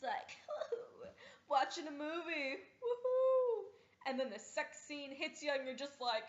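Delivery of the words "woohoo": cheer. Pretend. Cheering at movie.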